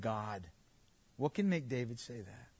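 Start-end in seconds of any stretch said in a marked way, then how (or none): 0.0-0.5 slow, soft, reverent prayer; tender prayer. Retold or imitated. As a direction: breathe it out as a quiet devotion. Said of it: "What makes him say".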